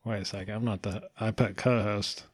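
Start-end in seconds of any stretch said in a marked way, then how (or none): 0.0-1.0 much softer, muttered realisation; quiet self-correction. Retold or imitated. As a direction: drop your voice as you catch the error. Catching own bio mistake.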